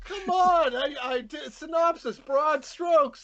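high-pitched, exasperated self-defence; indignant protest. Real, not acted. Host defends his synopsis to teasing co-hosts, indignant protest.

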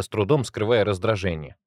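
usual speaking voice

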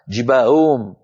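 "جباههم" as jibaa'um, ه softened to a slurred hamza; wrong lost ه. Shown as a demonstration. Teacher demonstrating the faulty sound, mispronounced. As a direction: blur both ه in "جباههم". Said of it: "وكأن الهاءات صارتا".

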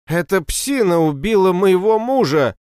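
high, loud hysterical cry; hysterical scream. A narrator's reading. Empress suddenly accuses before the crowd, screaming hysterically. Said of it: "закричала истерично".

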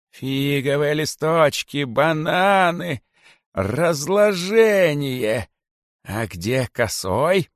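high, slow indignant listing; outraged king. A narrator's reading. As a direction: sputter with outrage. (возмущённый царь, медленно)

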